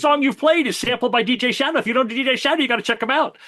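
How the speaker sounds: high, fast rush of fan enthusiasm; retold excitement. Telling the barista about a favorite DJ, excited. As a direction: eagerly urge it on them, high-pitched.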